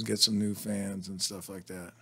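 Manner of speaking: usual speaking voice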